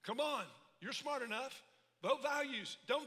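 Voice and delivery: loud, slowing preacher's shout; preaching climax. Acted. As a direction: build to a full-force call to vote.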